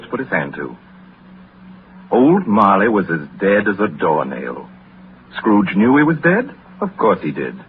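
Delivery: low, soft storytelling voice, unhurried and grave; story narration. A narrator's reading. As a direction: draw the listener into an old tale by the fire. Welles reads Dickens story narration.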